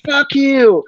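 high pitched fuck you; mock defiance. Actor cursing the fake cop, hammed-up defiance. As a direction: spit it at the cop.

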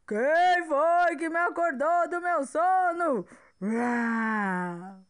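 loud booming dinosaur roar; angry T-Rex voice. Acted. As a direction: grumble like a woken giant. T-Rex asks again, roaring.

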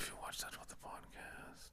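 hushed whispered suggestion. Pretend. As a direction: whisper it like a secret aside. Conspiratorial whispered aside to co-host.

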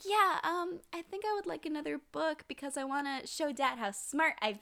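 put-on eager little girl. Little girl asking for another book, eager. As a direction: answer brightly as a small child. Child answering about present.

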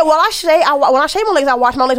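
high prissy mock voice, a touch hammed-up; mocking quote. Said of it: "she said".

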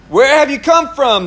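a hint of a commanding questioner; the Lord questioning. A narrator's reading. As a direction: read it as God putting a question to Satan. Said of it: "the Lord asked Satan".

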